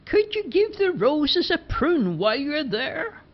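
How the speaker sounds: put-on high, slow old lady voice; Missus Dockery calling down. A narrator's reading. Missus Dockery calling from the upstairs window, high slow voice. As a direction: call down sweetly from the window. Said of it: "she shouted down".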